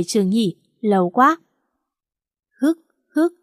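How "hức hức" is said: high little sob hiccups; hiccuping sobs. Acted. A girl still sniffling in the taxi hiccups with sobs. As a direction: catch the breath in small sobbing hitches. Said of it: "tôi còn những tiếng nấc nấc".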